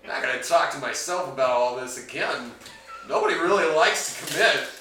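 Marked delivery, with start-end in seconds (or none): 3.1-4.7 exasperated. Acted. Exasperated rant.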